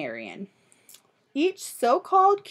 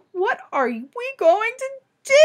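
higher, distressed mother; a worried mother crying out; cried out; 10 semitones higher, 3 dB louder than usual. Acted. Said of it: "cried Missus Cream".

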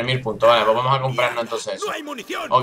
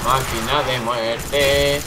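very slow, stretched words; drawn-out slow; much slower than usual. Shown as a demonstration. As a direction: savour each word slowly. Stretches the phrase slowly.

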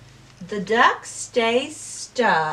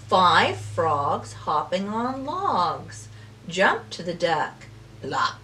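ordinary delivery, nothing marked

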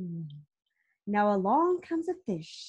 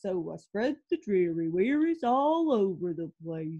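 gloomy pout fish; 4 semitones lower, 5 dB louder than usual. Acted. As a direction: drag it out like a sulky fish. Fish's gloomy refrain.

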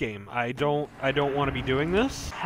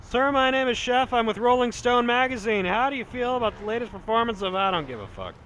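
mock interviewer; 7 semitones higher than usual. Plays a magazine interviewer.

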